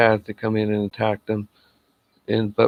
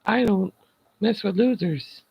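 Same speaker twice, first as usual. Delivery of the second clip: mock angry diva; 11 semitones higher than usual. Mocking Vicky Guerrero's angry rant in a high snooty voice. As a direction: ham up an offended diva. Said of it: "Vicky Guerrero was all pissed off".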